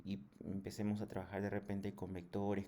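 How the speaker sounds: usual speaking voice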